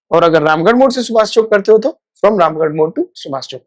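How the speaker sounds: ordinary delivery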